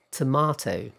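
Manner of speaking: tomato with a long "ah" and a crisp second t; British pronunciation. Shown as a demonstration. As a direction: say tomato as "tuh-MAH-toh". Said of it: "This is quite famous".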